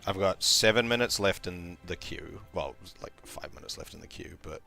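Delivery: ordinary talk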